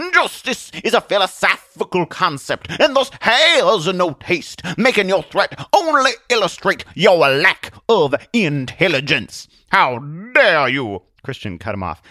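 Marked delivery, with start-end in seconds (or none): 0.1-9.5 high, slow, pompous preacher voice; grandly condescending, words drawn out; grinning, scornful Atheist. Acted. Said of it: "Atheist said with a grin". 9.7-11.0 loud, slow, high indignation; affronted Zealot. Acted. Zealot takes offence.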